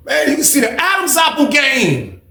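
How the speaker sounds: very high, slow and drawn out; incredulous squeal. Real, not acted. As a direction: exclaim in disbelief, voice way up high. Incredulous first take, then repeated low.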